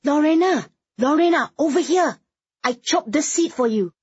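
high, loud calling out; shouting. A narrator's reading. Woman calling friend to a saved seat, high excited shout. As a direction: hail a friend across the room. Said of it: "Daisy shouted".

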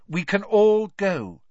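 loud, high, slow pointed hint. A narrator's reading. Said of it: "said Hermione pointedly".

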